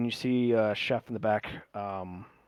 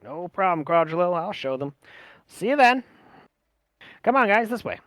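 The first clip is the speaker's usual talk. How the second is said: lightly voiced guide, rising to a loud beckon; a touch of chummy eagerness, calling the group along; obliging guide; 7 semitones higher, 5 dB louder than usual. Voices Billy leading the way.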